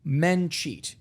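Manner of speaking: loud, high, emphatic argument; exasperated insistence. Retold or imitated. Telling a doubter that men cheat, exasperated. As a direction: hammer it home as plain fact.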